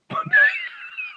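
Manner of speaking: high shriek of laughter. Real, not acted. Retelling the lottery story, shrieking laugh. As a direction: burst out laughing.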